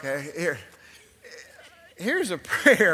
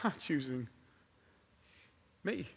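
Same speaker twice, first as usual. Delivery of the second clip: quiet; 3 semitones lower, 13 dB quieter than usual. Retold or imitated. Same thought again, hushed.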